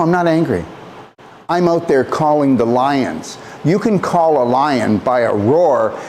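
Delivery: ordinary talk, nothing marked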